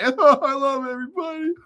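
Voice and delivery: high, hammed-up happy-cry voice; mock tearful. Overwhelmed by the news, telling viewers in mock tearful voice. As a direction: squeal it as if about to cry. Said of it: "I'm almost ready to cry".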